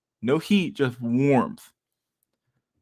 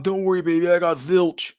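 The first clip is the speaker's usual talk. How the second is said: higher put-on husband, cocky reassurance; smug husband; 7 semitones higher, 3 dB louder than usual. Streamer voicing the husband reassuring his wife about Zilch, higher and smug. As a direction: toss it off like he has it handled.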